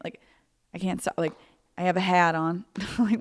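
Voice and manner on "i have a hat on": "have" and "hat" with exaggerated A's; "hat" said with an oddly accented vowel; weird accented A. Shown as a demonstration. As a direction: lean on the odd A in "hat". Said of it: "why am I talking like that?".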